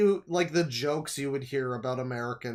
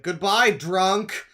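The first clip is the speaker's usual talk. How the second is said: mocking jeer; 6 semitones higher, 9 dB louder, much slower than usual. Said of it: "Someone's like".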